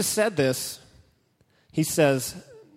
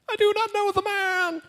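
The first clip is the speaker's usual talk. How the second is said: much higher, strained pleading cry; frantic denial. Acted. Peter pleading with the crowd, high frantic denial. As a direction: push the voice way up in panic.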